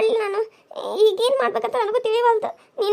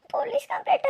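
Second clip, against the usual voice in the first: frightened whispered question; scared whisper; 4 semitones higher, 9 dB quieter, faster than usual. Acted. Wife hears the doctor mention police, scared whisper. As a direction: hiss the words in sudden fear.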